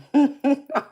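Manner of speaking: high, loud burst of giggling; squealing giggle; loud squealing laugh. Real, not acted. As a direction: shriek with laughter at your own joke. Laughing at buttery joke.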